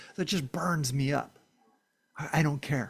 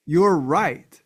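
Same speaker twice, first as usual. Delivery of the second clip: louder, higher insistence; emphatic; 4 semitones higher, 9 dB louder than usual. Real, not acted. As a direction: drive the point home loudly. Emphatic affirmation to viewers.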